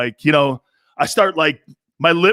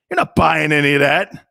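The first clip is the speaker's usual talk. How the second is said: ordinary delivery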